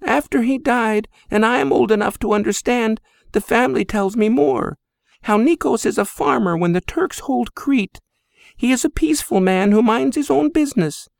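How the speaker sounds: high, tender mother's voice; fond Greek mother. Acted. Mother fondly compares her son to his great-grandfather.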